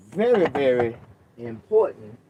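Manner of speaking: VERY, VERY hit hard and loud; very very punched loud; stress on very. Real, not acted. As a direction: lean on each very.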